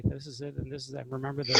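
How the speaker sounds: low, hushed, droning monotone. Shown as a demonstration. Chess teacher showing students how he doesn't talk, monotone. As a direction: drone it quietly with no lift. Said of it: "I'm not a monotonic talker".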